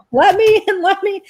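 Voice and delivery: high, loud frantic plea; high-pitched retold panic; imitating terrified neighbor. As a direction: beg frantically at the door. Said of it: "like, terrified".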